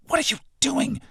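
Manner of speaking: hissing. Acted. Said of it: "she hisses".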